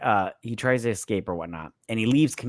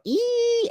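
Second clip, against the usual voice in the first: very high thin "eee"; imitated scream. Host mimicking the earpiece noise, imitated scream. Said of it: "that was the scream".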